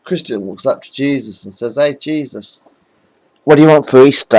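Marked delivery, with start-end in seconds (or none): 1.8-4.4 loud, higher call up to the cross; mock shout. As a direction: bellow it up at him like a heckler.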